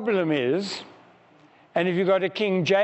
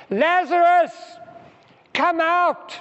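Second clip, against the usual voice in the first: much higher, much louder full shout; loud command; 12 semitones higher, 5 dB louder than usual. Shown as a demonstration. Preacher shouting Jesus's call to dead Lazarus, loud. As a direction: call Lazarus out of the tomb with all you have. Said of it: "I'm gonna give a loud voice".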